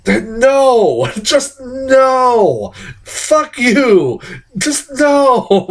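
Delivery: high, drawn-out indignant outburst; worked-up, hammed-up protest; exasperated refusal. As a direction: push each refusal up high, then let it fall. Ranting refusal of the sequel.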